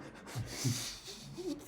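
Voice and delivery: high unvoiced wheeze laugh; breathless laugh. Real, not acted. Reacts to cartoon voice.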